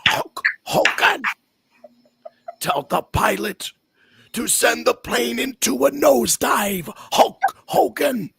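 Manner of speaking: high, slow, hammed-up snarl; Ultimate Warrior. Podcaster asked for the snarl, doing a mock wrestler growl. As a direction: strain out each phrase like a crazed wrestling promo. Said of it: "Do you do the snarl too?".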